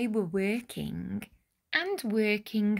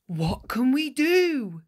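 raised, pompous mayor's question; mayor asking; 4 semitones higher, 5 dB louder than usual. Acted. Mayor Muddlenut asking the village hall, raised and pompous. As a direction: ask it like a fussy village mayor. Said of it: "Mayor Muddlenut asked them all".

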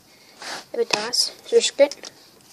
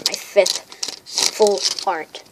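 slow, drawn-out emphasis; much slower than usual. Real, not acted. Card collector marking a milestone for viewers, slow emphatic repeat. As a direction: stretch out "fifth full art" word by word. Same count repeated slowly for weight.

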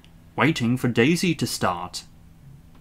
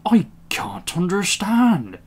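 slow, raised, exasperated; puzzled shunter; 7 semitones higher, slower than usual. A narrator's reading. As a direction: complain in bafflement. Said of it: "said the shunter".

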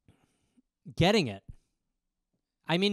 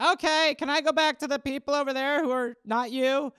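put-on higher, squirming child voice; a boy desperate to escape; uncomfortable kid; 6 semitones higher than usual. Boy asking his dad to let him go, higher.

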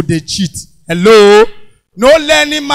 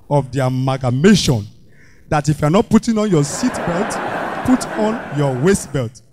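mock lawyer; 7 semitones lower, 5 dB quieter than usual. Lecturing police in fake legal English.

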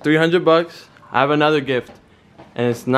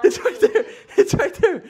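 much higher mock panic, breaking into laughter; fake rat panic. Son keeps the fake rat prank going, laughing mock panic. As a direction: fake fear you can't keep a straight face through. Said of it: "I'm gonna pretend that there's a rat in here".

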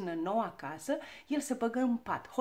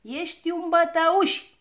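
high, loud, drawn-out taunt; shouted accusation; 7 semitones higher, 11 dB louder, slower than usual. A narrator's reading. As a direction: shout it slowly like a cheeky little girl. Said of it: "strigă ea". (strigăt ascuțit și tărăgănat)

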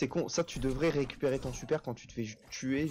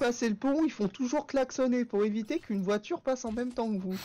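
put-on kids' game voice; 10 semitones higher than usual. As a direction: play the cheerful children's game guide. Imitating Adibou game voice.

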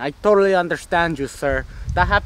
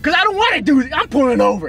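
shout; 8 semitones higher than usual. Acted. Heated car argument.

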